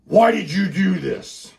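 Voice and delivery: heated parental outburst; angry dad. Acted. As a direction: confront a son furiously, word by word. Dad furiously confronts son.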